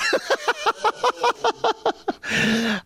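long, high-pitched run of laughter. Real, not acted. Host tickled by his stripper joke, laughing at length. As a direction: crack up at your own joke.

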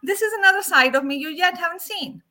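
loud, high, fast retort; defiant comeback. Retold or imitated. To someone judging her anger, a loud defiant retort. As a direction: warn them a new side is coming. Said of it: "I'm like".

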